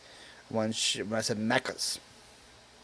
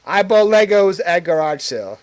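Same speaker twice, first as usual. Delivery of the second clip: louder, punchy headline read; mock title pitch; 4 semitones higher, 7 dB louder than usual. Shown as a demonstration. Streamer pitching own next video title, loud. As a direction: announce it like a viral video. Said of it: "I wonder if that's gonna work for me".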